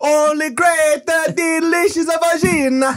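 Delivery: high, slow mock Italian, drawn out; Italian accent. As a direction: stretch it out as a comic Italian. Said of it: "we did an Italian accent".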